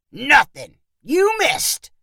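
high, mildly shouted taunt; mocking call, pitched high; jeering shout. A narrator's reading. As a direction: crow gleefully at a miss. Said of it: "Perry shouted with a laugh".

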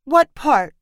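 hurt and wary, asking. A narrator's reading. Grandma questions granddaughter about new spot, hurt. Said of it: "with a hurt voice".

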